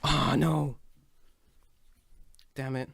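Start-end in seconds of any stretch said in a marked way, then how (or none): none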